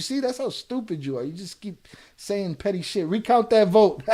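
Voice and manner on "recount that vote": laughing loud read. Real, not acted. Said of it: "That's funny".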